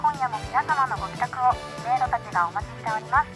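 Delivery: polished show intro read; announcer voice. Acted. Radio show opening as maids.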